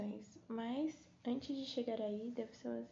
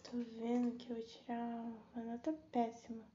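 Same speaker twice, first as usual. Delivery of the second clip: ordinary delivery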